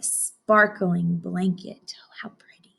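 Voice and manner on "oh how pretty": soft awed whisper. Real, not acted. Teacher admiring the sparkling forest, whispered. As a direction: hush it like a secret. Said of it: "teacher admires the forest".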